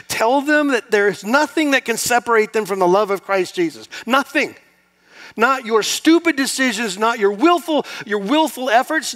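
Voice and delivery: loud, high impassioned preaching. Acted. Pastor urging the congregation to tell others of grace, impassioned.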